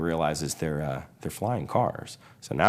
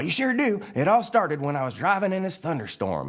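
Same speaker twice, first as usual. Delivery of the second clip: high-pitched eager storyteller truck; Mater; 12 semitones higher than usual. Acted. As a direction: launch into a tall tale, pitched way up. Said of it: "Mater's all".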